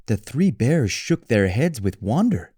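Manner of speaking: ordinary delivery, nothing marked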